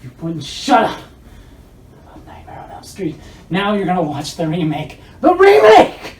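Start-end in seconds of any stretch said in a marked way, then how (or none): 2.0-3.2 menacing whisper. Acted. Menacing threat in comedy sketch. 5.2-6.2 shrieked high and loud; dramatic shout. Acted. Threat then shouted repeat.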